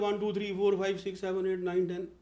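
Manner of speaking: वन to टेन rattled off evenly, Indian sounds; common wrong counting. Shown as a demonstration. Coach imitates how we all count, wrong and run-together. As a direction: run वन, टू, थ्री together in a monotone chain. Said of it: "उसको भी हम सभी गलत बोलते हैं".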